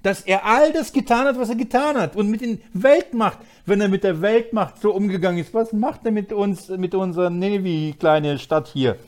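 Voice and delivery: ordinary talk, nothing marked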